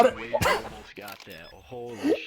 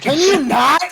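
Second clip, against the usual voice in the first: sharp, irritated question, louder and higher; annoyed; 8 semitones higher, 5 dB louder than usual. Real, not acted. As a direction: snap back in annoyance. Annoyed reply after getting hurt.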